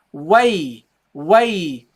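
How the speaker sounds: "way" stretched long, gliding slowly onto the ya; drawn-out syllable. Shown as a demonstration. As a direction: say "way" slowly as one joined unit. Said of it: "So in this case, you say".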